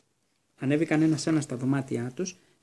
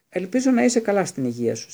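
high, loud, pompous well-wishing; 6 semitones higher, 6 dB louder than usual. A narrator's reading. Percy shaking Harry's hand, pompous and grand. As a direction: declaim it with stiff ceremony. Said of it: "συνέχισε με στόμφο ο Πέρσι".

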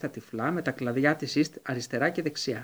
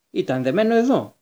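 a touch of anger, somewhat louder and higher; mildly irritated insistence; angry executioner; 4 semitones higher, 6 dB louder than usual. A narrator's reading. Executioner insisting to the committee, mildly angry. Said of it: "είπε θυμωμένος ο Δήμιος".

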